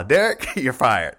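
imagined boss firing him through laughter; mock firing.

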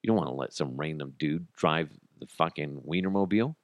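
normal talking voice

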